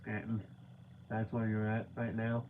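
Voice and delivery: mock-deadpan clerk naming where you are; deadpan nonchalant. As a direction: toss off "Scranton" like it means nothing. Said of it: "he doesn't even say it with any kind of, he's just like".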